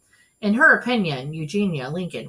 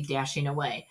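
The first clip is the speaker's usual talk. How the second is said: plain reading voice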